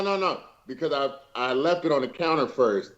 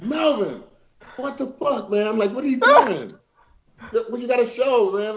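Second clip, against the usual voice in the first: exasperated scold; 8 semitones higher than usual. Retold or imitated. Retelling scolding Melvin over cake.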